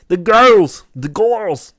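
loud, high hammed-up Gru cry. Gamer slipping into a loud, high Gru impression. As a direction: belt it like Gru about his girls. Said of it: "how Steve Carell came up with the Gru voice".

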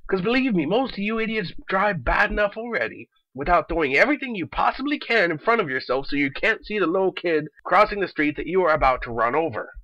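usual speaking voice

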